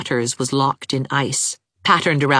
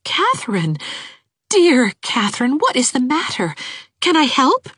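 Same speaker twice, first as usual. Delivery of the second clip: gentle concern; 10 semitones higher than usual. Acted. Anne comforts crying Catherine.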